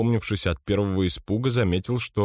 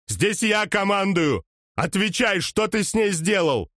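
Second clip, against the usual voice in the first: high desperate shout; 12 semitones higher than usual. Acted. Gunman demands answers.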